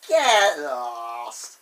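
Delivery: hammed-up loud, drawn-out exclamation; loud disbelief. Thomas refusing to believe his discovery, loud mock disbelief.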